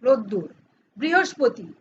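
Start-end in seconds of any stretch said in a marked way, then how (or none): 0.0-0.5 রোদ্দুর as "rod-dur", pitch dropping low; everyday রোদ্দুর with a doubled d; colloquial pronunciation. Shown as a demonstration. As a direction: say রোদ্দুর the spoken way, ending in -ur. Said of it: "কি বলি?".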